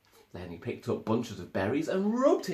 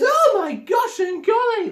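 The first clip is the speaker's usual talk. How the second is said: high, loud, shocked cry; startled exclamation; 12 dB louder than usual. Acted. The startled elephants cry out, high and loud. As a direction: gasp it out in shock. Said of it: "they said".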